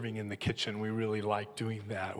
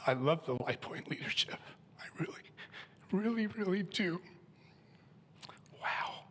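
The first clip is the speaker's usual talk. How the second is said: softer, half-whispered, then cracking high; overcome with emotion; 6 semitones higher, 3 dB quieter than usual. Real, not acted. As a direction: barely get the words out through tears. Moved to tears at the pulpit.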